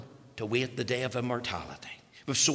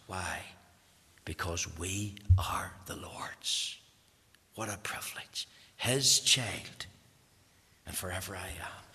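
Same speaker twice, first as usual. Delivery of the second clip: whisper; 5 semitones lower, 4 dB quieter than usual. Real, not acted. Preacher reminding the mourners they are the Lord's, whispered. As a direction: hush it like a treasured secret. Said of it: "tender climax of sermon".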